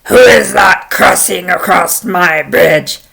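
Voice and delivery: loud, slow troll challenge; menacing bridge-guard demand; horrible troll. Acted. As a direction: boom it out slowly as the troll. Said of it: "And a voice said".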